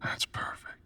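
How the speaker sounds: hushed, savouring whisper; admiring whisper. Acted. Seducer admiring the listener lying on the altar, whispered. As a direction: breathe the compliment in close.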